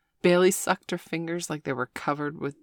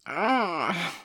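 grumbling; 3 semitones higher than usual. A narrator's reading. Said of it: "Bailey grumbled".

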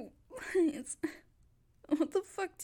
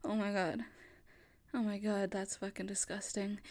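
appalled murmur; 6 semitones lower, 7 dB quieter than usual. Acted. Appalled at the scene.

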